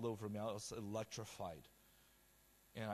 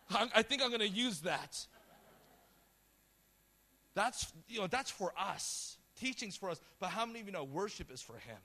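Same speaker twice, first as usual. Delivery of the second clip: raised, high-pitched pulpit voice; impassioned preaching; 8 semitones higher, 7 dB louder than usual. Real, not acted. Preacher to church about worship being for him, impassioned preaching. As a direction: preach it with force to a crowd.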